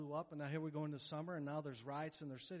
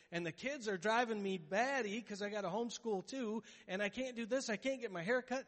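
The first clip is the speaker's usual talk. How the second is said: higher, whiny list of complaints; whiny complaining; 5 semitones higher, 4 dB louder than usual. Retold or imitated. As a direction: moan about everything going wrong. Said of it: "don't you feel like you have no power".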